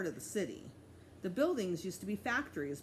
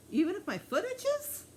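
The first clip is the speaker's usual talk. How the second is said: put-on high, louder little boy voice; a cheeky kid asking, pitched up high; childish question; 13 semitones higher, 5 dB louder than usual. A narrator's reading. Fudge asking the cop about his itchy foot, high kid voice.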